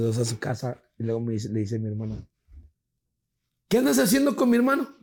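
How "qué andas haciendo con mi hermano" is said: high, loud mock demand; interrogating sister. Sister questions brother's girlfriend, demanding. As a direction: imitate a disapproving sister grilling someone.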